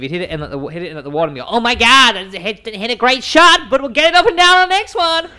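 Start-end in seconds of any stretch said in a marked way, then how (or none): 1.5-5.3 imitating an over-excited American golfer, very high and loud; hammed-up upbeat yell; relentlessly positive. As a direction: cheer on a bad shot, stretched out. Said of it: "so positive".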